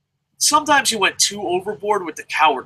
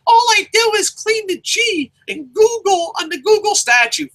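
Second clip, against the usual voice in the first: much higher put-on whiny voice; mock whiny employee; 3 dB louder than usual. Google janitor pleading innocence in a high whiny voice. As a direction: squeak it out like a pleading worker. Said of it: "if you're like".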